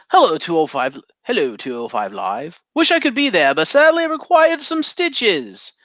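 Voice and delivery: put-on promo voice, climbing high; Jack Gallagher. Host replaying a wrestler's pre-recorded promo, impersonated. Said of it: "pre-recorded promo from Jack Gallagher".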